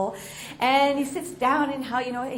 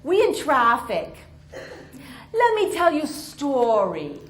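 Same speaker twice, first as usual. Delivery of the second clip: put-on very slow, gentle Vietnamese-accented offer; 2 semitones higher, 4 dB louder, much slower than usual. Driver offering a story in traffic, Vietnamese accent. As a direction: take your time, Vietnamese accent, kindly. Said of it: "he says".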